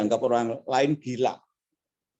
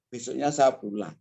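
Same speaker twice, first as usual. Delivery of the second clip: ordinary talk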